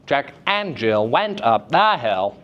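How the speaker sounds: "and", "went" and "the" punched oddly; unnatural stress pattern. Shown as a demonstration. Teacher showing the class a broken pattern, unnatural stresses on "and" and "the". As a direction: stress the wrong words, "and" and "the", heavily. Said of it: "take it outside of its nat".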